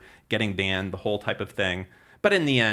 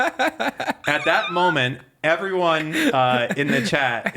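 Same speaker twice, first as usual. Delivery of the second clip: laughing; 4 semitones higher, much slower than usual. Real, not acted. Still laughing from the story.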